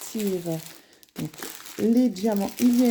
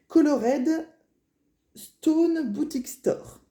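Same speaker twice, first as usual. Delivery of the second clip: English name in a ch'ti French accent, higher, deliberately spaced out; 5 semitones higher, slower than usual. Shown as a demonstration. Vlogger reading out the shop's English name, French ch'ti accent. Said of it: "mon accent français ch'ti".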